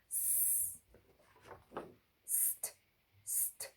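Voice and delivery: whispered "S-, st-, st" building the st blend; sounding out blend. Shown as a demonstration. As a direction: hiss the s then add t. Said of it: "let's try my turn".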